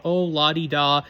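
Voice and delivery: mock them with a hint of cackle; lightly cackled taunt. A narrator's reading. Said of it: "Peeves cackled".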